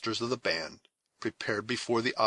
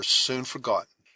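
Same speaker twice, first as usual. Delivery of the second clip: ordinary delivery, nothing marked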